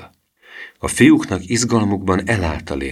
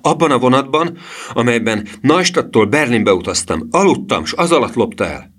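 put-on higher-pitched, insistent boy's accusation; mildly heated, pitched up; excited accusation; 6 semitones higher than usual. A narrator's reading. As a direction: blurt out the charge in a raised voice. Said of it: "kiáltotta Emil a pénztár elé ugorva".

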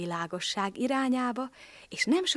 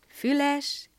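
usual speaking voice

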